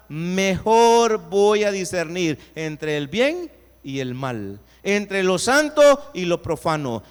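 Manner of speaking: loud, building preached climax; fervent pulpit crescendo; preaching at full force. Acted.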